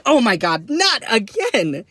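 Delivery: loud, high cry of dismay; mock panic. Traveller seeing his bag flagged, loud panicked dismay. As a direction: wail it slowly, rising in exasperation.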